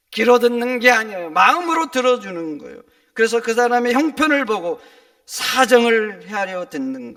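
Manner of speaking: loud, emphatic sermon delivery; forceful pulpit voice; full-force preaching. Acted. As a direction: drive the point home to the congregation.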